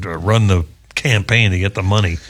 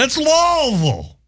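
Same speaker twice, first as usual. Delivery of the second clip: high, drawn-out holler without real volume; mock shouting; slower than usual. Passengers correcting the flight attendant, high mock shout. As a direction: holler Louisville as a long LAWL-vul. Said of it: "people started shouting".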